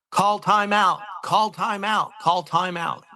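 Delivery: high-pitched mock sideline call, no real shout; imitating Coach Fonts. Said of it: "I hear very distinct voice".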